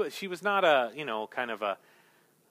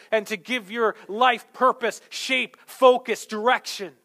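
raised, higher, driving pulpit voice; impassioned preaching; 8 semitones higher, 6 dB louder than usual. Real, not acted. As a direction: preach it with force, leaning on the key words.

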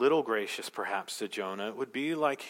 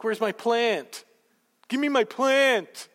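put-on high, slow, loud baby whine; whiny big baby; 10 semitones higher, 6 dB louder, slower than usual. Jonah to God over his lost plant, babyish whine. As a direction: whine like a toddler who lost his toy. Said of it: "A big baby".

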